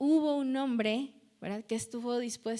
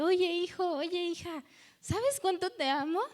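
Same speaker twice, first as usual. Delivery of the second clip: God calling tenderly, pitched higher; God lovingly calling his children, voiced higher; 6 semitones higher than usual. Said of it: "el Señor está ahí hablándote".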